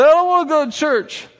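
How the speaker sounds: put-on loud, high-pitched childish refusal; protesting son. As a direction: whine it like a kid refusing to go. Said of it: "He says".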